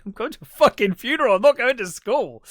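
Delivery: loud, high, fast outburst; exasperated protest. Retold or imitated. Son correcting parents about the funeral, mock exasperated.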